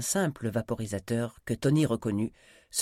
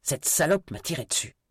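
higher, indignant protest; angry accusation; 6 semitones higher, 4 dB louder than usual. Acted. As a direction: spit out the grievance. Wounded man accuses shooter.

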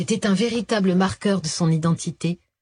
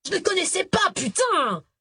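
sudden high angry outburst; flaring up; 12 semitones higher than usual. A narrator's reading. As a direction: blurt it, losing his temper. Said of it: "s'énerva-t-il".

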